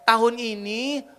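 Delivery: loud, high, rousing sermon proclamation; fervent preaching. Real, not acted. Preacher proclaims a year of victory to the congregation, fervent preaching. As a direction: drive each phrase home at full force.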